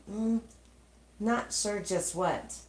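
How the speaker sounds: ordinary delivery, nothing marked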